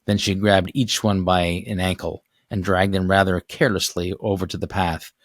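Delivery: ordinary delivery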